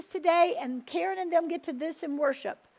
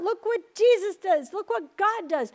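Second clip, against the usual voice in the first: excited; 5 semitones higher, 5 dB louder than usual. Retold or imitated. Believers rejoicing together after release.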